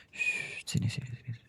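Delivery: hushing her, whispered and soft; calm her down under your breath; soothing whisper. Acted. Husband comforting his crying wife. (bisikan menenangkan istri yang menangis)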